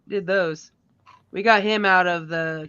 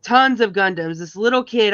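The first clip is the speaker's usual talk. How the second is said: ordinary delivery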